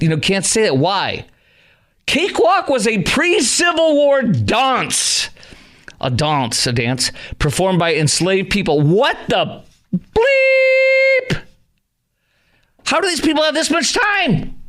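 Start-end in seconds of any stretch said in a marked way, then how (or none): 2.1-5.3 much higher and slow, sarcastically grave; intoning the reason with mock gravity; mock solemn explanation. Mocking the banned-word explanation. 8.8-14.6 very high, drawn-out stunned outcry; real disbelief at the absurdity, pitched way up; exasperated disbelief. Real, not acted. Host's exasperated outburst.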